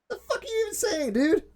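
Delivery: high, incredulous outburst. Real, not acted. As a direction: pitch leaps up in disbelief. Incredulous outburst about Matt Bell.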